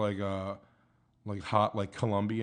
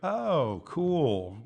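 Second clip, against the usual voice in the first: high, put-on show of interest; fake enthusiasm; 5 semitones higher than usual. People told he's a comedian, answering with fake interest. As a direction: feign being impressed, starting high. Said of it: "like, fake".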